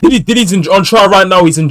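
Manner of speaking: bursting in with the news; loud, high excited interruption; excited blurt. Real, not acted. Excited interjection about trial.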